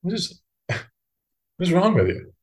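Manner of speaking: mock-exasperated inner voice; incredulous thought. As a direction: question it as if baffled, half laughing. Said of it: "And I just think".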